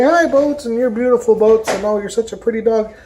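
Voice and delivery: high, sweet praise for a dog; high sing-song dog praise; cooing at dog. Retold or imitated. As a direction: coo at the dog like a fond neighbour. Townspeople greeting the dog.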